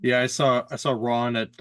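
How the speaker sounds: normal talking voice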